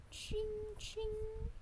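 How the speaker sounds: high, soft "ching, ching"; sing-song jingle. Acted. Gamer admiring the beautiful key, soft high chiming. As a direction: chime it lightly like a jingling key.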